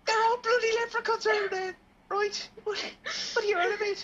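squeaky, very high Irish protest; leprechaun Irish accent. Shown as a demonstration. Host defending the accent, in very high leprechaun Irish. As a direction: protest in an over-the-top leprechaun brogue. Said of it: "That's a leprechaun accent".

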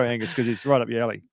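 ordinary talk, nothing marked

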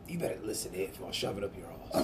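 low, soft, gruff British tough guy; hushed British action-hero threat; Jason Statham impression. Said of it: "Sounds like Jason Statham".

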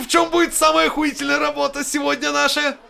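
high, loud hype intro; show announcer. Acted. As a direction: announce the show with big excitement.

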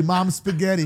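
ordinary talk, nothing marked

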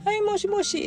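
very high polite phone voice. Retold or imitated. 母が電話に出て急に甲高い声で. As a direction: jump up into a sweet phone voice. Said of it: "急に声がね変わる".

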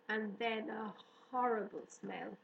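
ordinary delivery, nothing marked

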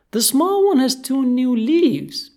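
high, loud, slow fish voice; eager goldfish announcement. Acted. Goldie coaxing Kat with news of leaves, eager. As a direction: announce news excitedly, drawn out.

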